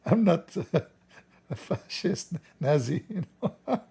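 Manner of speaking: high, giggling denial; laughing protest. Retold or imitated. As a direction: squeak it out while laughing. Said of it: "He said".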